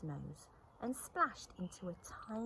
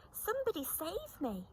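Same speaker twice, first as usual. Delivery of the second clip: panicked; 10 semitones higher than usual. A narrator's reading. Rabbit spinning in panic.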